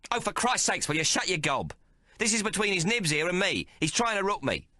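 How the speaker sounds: furious Maggie. A narrator's reading. Maggie cursing the tailor for cheating, furious. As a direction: tear into him.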